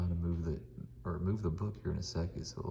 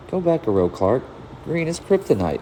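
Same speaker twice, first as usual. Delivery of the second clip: higher-pitched voice, bossing a friend at a board game; teasing game player; 9 semitones higher, 14 dB louder than usual. Acted. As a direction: call out his move with playful authority. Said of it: "try to change my voice a little".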